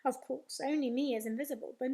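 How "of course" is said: usual speaking voice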